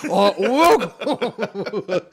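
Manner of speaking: mock formal welcome. Host greeting guests at the imagined club, slow and hammed-up. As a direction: stretch the welcome out like a solemn host. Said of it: "conference room with dudes like".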